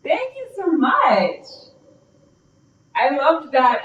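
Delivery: high, drawn-out thank you; gushing thanks. Real, not acted. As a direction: pour on the thanks, high and slow. Thanking classmate after her turn.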